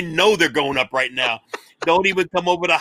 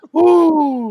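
excited whoop; 11 semitones higher, 7 dB louder than usual. Real, not acted. Whooping at the joke.

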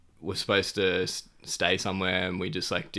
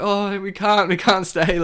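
high apologetic refusal; 11 semitones higher, 7 dB louder than usual. Retold or imitated. Declining more tea.

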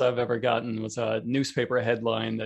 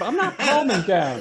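mock defiance; 10 semitones higher, 4 dB louder than usual. Riffing as the enraged elephant.

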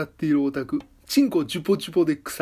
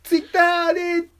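excited; 12 semitones higher, 4 dB louder than usual. Real, not acted. Excited rant about one follower.

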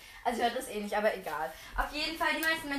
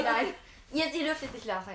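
ordinary delivery

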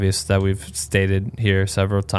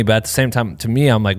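normal talking voice

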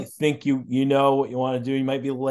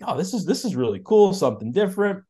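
put-on higher-pitched, excited retelling of a young reaction; 6 semitones higher, faster than usual. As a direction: re-enact being keen on something new. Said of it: "so excited about it".

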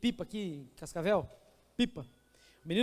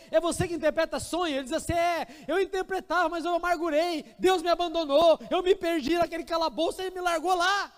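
bitter Joseph; 8 semitones higher, 4 dB louder than usual. Acted. Joseph voiced despairing.

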